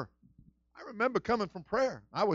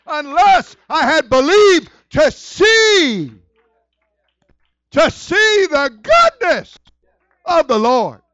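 loud, high, slow scripture cry; preached scripture; 9 semitones higher, 14 dB louder, slower than usual. Acted. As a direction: call the verse out over the church. Quotes scripture while preaching.

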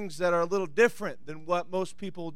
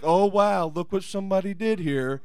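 mopey attitude. Acted. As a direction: act out a listless, underwhelmed reaction. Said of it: "with a mopey attitude like".

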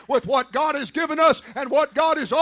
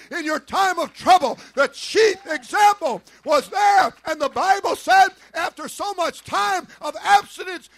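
preaching at full force; 8 semitones higher, 7 dB louder than usual. Acted. Preacher promising the congregation God's strength, shouted preaching. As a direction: pound each line out to the pews. Preacher building to loud climax.

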